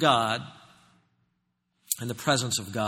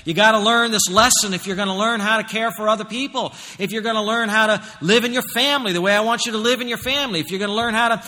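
high, loud, driving sermon delivery; full-force preaching; 8 semitones higher, 7 dB louder than usual. Acted. Preacher voicing God's demand to his people, full-force preaching. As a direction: preach it hard, building higher and louder.

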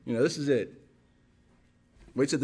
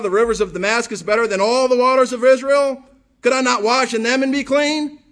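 loud, higher angry protest; furious; 5 semitones higher, 9 dB louder than usual. Acted. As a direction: rage at the insult, full voice. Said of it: "Naaman was furious".

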